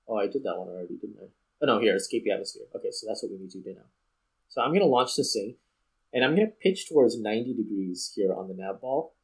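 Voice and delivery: ordinary delivery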